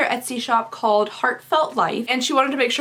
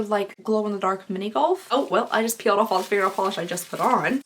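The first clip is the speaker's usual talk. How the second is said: normal talking voice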